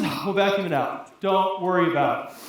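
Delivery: low-pitched, casual reassurance; unbothered friend. Acted. Friend to worried traveller about powder mess, nonchalant. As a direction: brush it off in a deep, easy voice.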